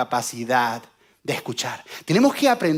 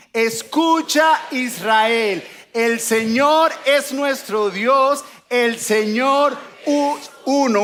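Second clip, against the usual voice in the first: high, loud, very slow unison reading; loud, slow, led by the preacher; loud group recital; 6 semitones higher, much slower than usual. Shown as a demonstration. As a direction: drag each word out so everyone reads along. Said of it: "ahora todos juntos en español".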